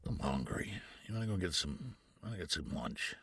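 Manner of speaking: a hint of a low, soft older man; low and quiet, a casual invitation; lightly voiced Jonathan. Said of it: "he's like".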